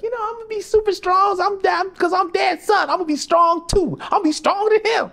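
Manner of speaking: put-on high, excited kid voice; a boy bragging to his mama; little boy. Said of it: "he's like".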